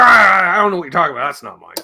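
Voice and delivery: loud flustered denial; mock denial. Father denying the fuzz box is his, loud and flustered. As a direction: deny it all in a blustery rush. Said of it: "And he's like".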